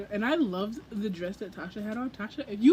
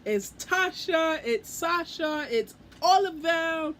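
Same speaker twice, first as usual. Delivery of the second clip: drawn-out, higher, awed gushing; starstruck gasp; 4 semitones higher, slower than usual. Retold or imitated. Fan spotting her favourite booktubers, slow awed gushing. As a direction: gush as if spotting idols. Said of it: "my heart dropped because I'm like".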